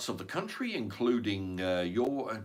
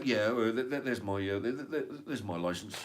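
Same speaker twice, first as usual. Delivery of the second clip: stammering license man. Retold or imitated. Inspector fumbling to show his license, mock stammer. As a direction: fumble the words like a flustered inspector. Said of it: "So, so him going".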